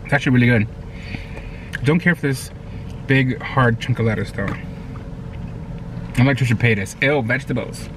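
chewing between words; talking while chewing. Real, not acted. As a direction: mouth full of burger. Seen 2: Right man chews with closed, full cheeks between lines, pulling out lettuce.

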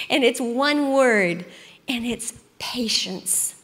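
slowed right down, "patience" given full weight; slow, weighty emphasis. Real, not acted. Speaker telling the group what to write atop their notebooks, slow and weighty. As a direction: lean on each word so it sinks in. Naming the one key word.

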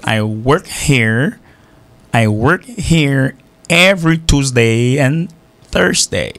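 slow, careful American English; teacher to radio students, modeling it in an American accent. Shown as a demonstration. Slowly spelled-out American English, I work here every Tuesday and Thursday. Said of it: "un accent américain".

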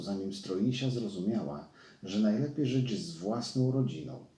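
plain reading voice

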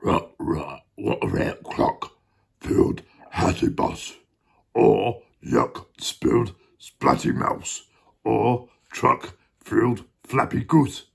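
low, slow growly dog; slow, rough doggy read. Acted. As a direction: voice a deep, husky dog. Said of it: "said the dog".

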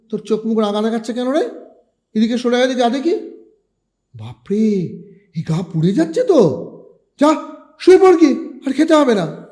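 rising alarm at his fever; alarmed mother. A narrator's reading. Mother finding her son burning hot, alarmed and loud.

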